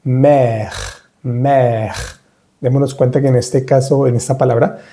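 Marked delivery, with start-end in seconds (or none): 0.0-1.9 mère with open è and throaty French r; slow, careful French mère; French mère demo. Shown as a demonstration. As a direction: draw out mère, silent final e. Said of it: "vamos a decir".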